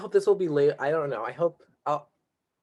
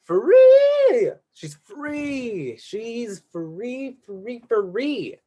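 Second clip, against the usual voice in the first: excited; 8 semitones higher, 4 dB louder, much slower than usual. Pretend. Celebrating friend's eye freed.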